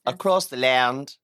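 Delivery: put-on slow plummy English posh accent. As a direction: announce it like a posh English presenter. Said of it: "Oh, that's very plummy".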